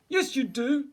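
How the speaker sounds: high, loud bear retort; insisting bear. A narrator's reading. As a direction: contradict it firmly and loudly.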